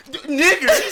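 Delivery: high, loud yelled punchline; meme shout. Retold or imitated. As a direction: blurt it out laughing.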